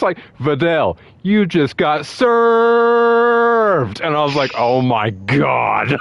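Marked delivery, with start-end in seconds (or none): 0.4-4.0 much higher, very slow, stretched-out taunt; drawn-out burn. Retold or imitated. Sonic account burning Fidel, slow gloating taunt. 4.6-6.0 very slow, drawn-out disbelief; stunned gasp. Retold or imitated. Podcaster stunned by the burn, drawn-out gasp.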